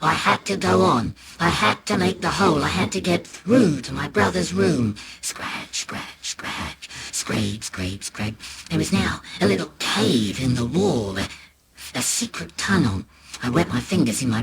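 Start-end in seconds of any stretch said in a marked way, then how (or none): 5.2-8.3 breathy whispered scratch sounds. A narrator's reading. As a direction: whisper the scraping noises. Scratching sound effect words.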